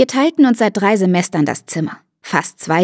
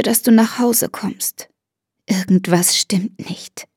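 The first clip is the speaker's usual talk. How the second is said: whisper. Acted. Mother pleading, whispering.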